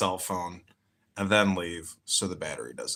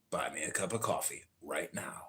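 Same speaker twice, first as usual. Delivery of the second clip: whispering; 5 semitones lower, 10 dB quieter than usual. Acted. Aside plea for support.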